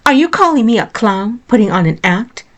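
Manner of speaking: angry. A narrator's reading. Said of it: "anger shot through her".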